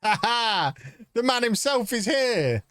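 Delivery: high, delighted call out; excited greeting. Real, not acted. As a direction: greet him like a star. Greeting a player arriving.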